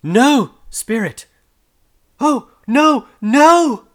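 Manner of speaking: very high, loud, desperate no; anguished cry. A narrator's reading. Scrooge denying his fate before the spirit, desperate shout. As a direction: scream the denial in terror.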